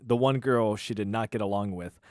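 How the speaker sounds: usual speaking voice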